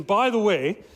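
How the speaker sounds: ordinary delivery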